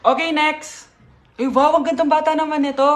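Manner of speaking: ordinary delivery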